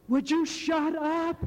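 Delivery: put-on a frail old man's cranky retort; dying dad snapping. Dying father telling his son to stop the spiritual chatter, high and cranky. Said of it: "he goes".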